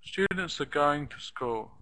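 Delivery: Students are going to school with "are" and "to" as short schwas; reduced function words. Shown as a demonstration. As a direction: squeeze "are" and "to", stress STU, GO, school. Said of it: "reduced. They're made sh-, shorter".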